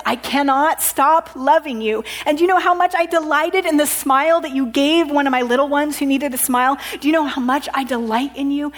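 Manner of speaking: normal talking voice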